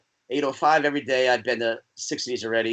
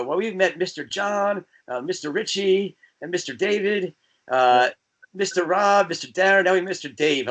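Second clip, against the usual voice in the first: higher, slow, grand roll call of names; mock band intro; 6 semitones higher than usual. Band members at dinner introducing the guys, hammed-up slow roll call.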